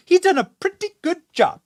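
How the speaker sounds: high, squeaky mocking voice, way up; mock Biden defender. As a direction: squeak it out like a flustered spokesperson. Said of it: "you say".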